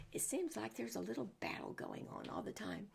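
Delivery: plain reading voice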